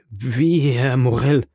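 tight, compressed, drawn-out disbelief; choked voice. A narrator's reading. Dismayed sailor to his shipowner, in a choked voice. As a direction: squeeze the words out through a tight throat. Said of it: "sagte er mit zusammengepresster Stimme".

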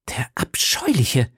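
slow, emphatic stage whisper; whispered exclamation. A narrator's reading. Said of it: "rief Madame Bonacieux".

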